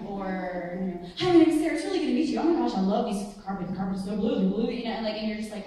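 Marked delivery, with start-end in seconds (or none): 1.2-4.7 loud, rushed manic chatter, tumbling words; pressurized speech. Shown as a demonstration. Demoing manic speech to the audience, rushed and loud. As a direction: gush about the carpet without a breath. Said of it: "do a good impression of that".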